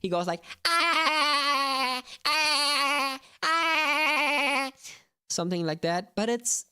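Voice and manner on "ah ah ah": high SpongeBob laugh, staccato. Retold or imitated. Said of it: "when SpongeBob is, like, laughing".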